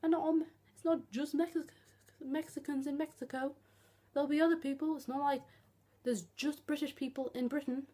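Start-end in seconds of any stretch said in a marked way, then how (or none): none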